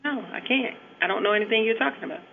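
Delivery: ordinary delivery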